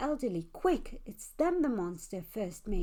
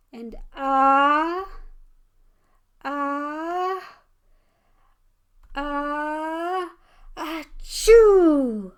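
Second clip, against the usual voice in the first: loud building ah-ah-ah then explosive achoo; a hammed-up building sneeze ending in a loud burst; big sneeze; 6 semitones higher, 13 dB louder, much slower than usual. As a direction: wind up and blast a huge sneeze. Child sneezes.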